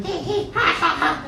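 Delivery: very high-pitched monkey chatter; screaming monkeys. Retold or imitated. Comedian imitating the monkey troop around him, screechy chatter. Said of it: "cacophony of monkeys screaming, yelling".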